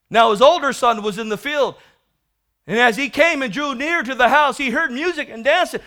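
loud, high preaching cry; fiery Sunday preacher voice; preaching at full force. Acted. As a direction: preach it out to the congregation. Preaching the prodigal son at full force.

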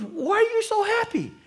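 very high, comic puzzled voice; baffled question. Acted. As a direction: ask it in squeaky mock bafflement. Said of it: "He goes".

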